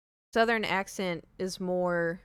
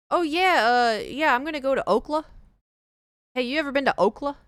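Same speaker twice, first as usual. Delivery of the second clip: higher, louder mock-casual voice; mocking abbreviator; 6 semitones higher, 5 dB louder than usual. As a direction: parody a person who says "Okla". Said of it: "you're not gonna hear, like, Oklahoma".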